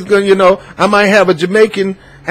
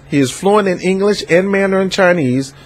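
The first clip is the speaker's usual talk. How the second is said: usual speaking voice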